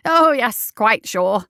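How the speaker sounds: overly eager reassurance; emphatic. A narrator's reading. Doctor overdoes reassuring the sentry: emphatic. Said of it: "nodded emphatically".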